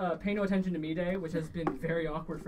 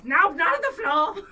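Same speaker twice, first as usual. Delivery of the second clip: high, loud indignant cry; fussy old elf; 12 semitones higher, 13 dB louder than usual. Acted. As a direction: protest about the floor in alarm.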